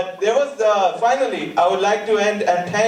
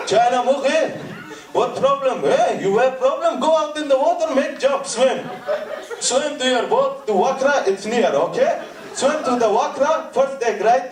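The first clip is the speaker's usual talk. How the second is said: put-on higher-pitched, heavily accented telling-off; mock-angry scolding; 3 semitones higher than usual. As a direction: scold him like an exasperated boatman. Crew's acted scolding of the comedian.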